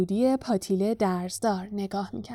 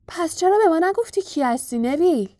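higher-pitched cheerful question; grinning; 7 semitones higher, 4 dB louder than usual. A narrator's reading. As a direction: ask it teasingly with a grin.

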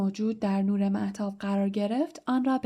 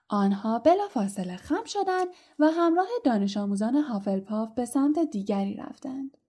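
ordinary delivery

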